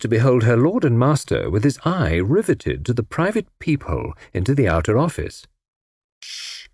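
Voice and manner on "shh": soft hushing "shh"; warning shush. A narrator's reading. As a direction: shush someone in warning, very softly. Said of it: "said Tommy warningly".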